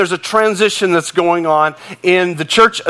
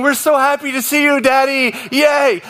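higher, excited little dog voice; happy dogs' voice; 6 semitones higher than usual. Retold or imitated. Pastor voicing his chihuahuas greeting him, higher and gleeful. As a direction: voice the chihuahuas gushing over Daddy. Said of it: "They were saying".

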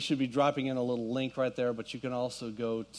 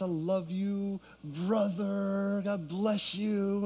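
tender; 7 semitones higher, much slower than usual. Retold or imitated. Retold message of love to brother.

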